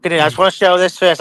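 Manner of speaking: normal talking voice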